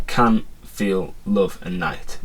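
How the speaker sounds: "can", "feel", "love", "night" each given its own weight as a separate word; stressed words listed. Shown as a demonstration. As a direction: pick out each stressed syllable one by one. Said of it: "if you were just to say it".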